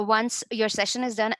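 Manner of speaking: ordinary talk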